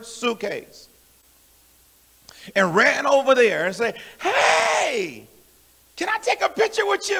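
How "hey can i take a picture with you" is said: high-pitched starstruck squeal of a call; excited auntie. Retold or imitated. Auntie running up to a celebrity at a reunion, wildly excited. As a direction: call out, thrilled to spot someone famous. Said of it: "She was so excited".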